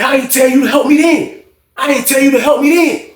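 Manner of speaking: high-pitched mock protest; indignant retort. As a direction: throw it back, high and defensive.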